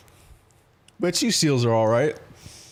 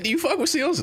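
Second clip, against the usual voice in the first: high-pitched imitation of her question; put-on voice for her; 11 semitones higher than usual. Streamer mimicking the raider asking about seals, high put-on voice. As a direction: quote her in a much higher put-on voice. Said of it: "I gave you that voice".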